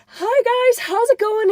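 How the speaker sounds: imitating a very friendly hello, high and loud; super nice greeting. Retelling the actor greeting fans, super nice. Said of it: "he's super nice".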